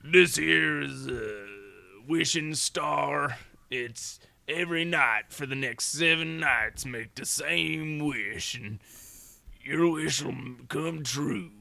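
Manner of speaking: slow, drawn-out gruff cowboy. Retold or imitated. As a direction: growl it like a weird old cowboy. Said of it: "weird, like, insane, like, gruff cowboy voice".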